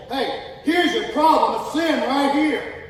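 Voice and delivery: high, loud and slow, calling out sin; Jesus calling out. Acted. Jesus confronting sin, shouted slow and high. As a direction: slowly shout it, pointing the finger. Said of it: "He would say".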